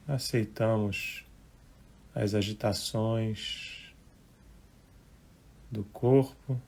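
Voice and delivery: unhurried, hushed relaxation guidance; guided meditation. Real, not acted. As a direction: gently guide listeners into relaxing, phrase by phrase.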